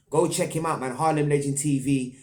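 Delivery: usual speaking voice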